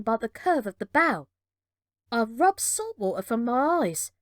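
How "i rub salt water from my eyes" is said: usual speaking voice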